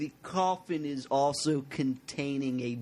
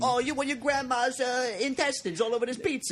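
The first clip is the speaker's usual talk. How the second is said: mock Italian accent; 9 semitones higher than usual. Pizza man pitches coffin pizza to a customer in hammed-up Italian accent. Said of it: "offensive Italian accents".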